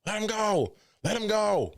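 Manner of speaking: imitating a yell. Boss yelling at Punk to let him go, imitated high yell. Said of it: "Khan was yelling at Punk".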